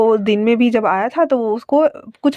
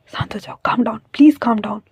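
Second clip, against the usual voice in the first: whisper; slower than usual. Acted. Wang Ji soothing frightened Wing, slow whisper. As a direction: hush him gently in a slow whisper.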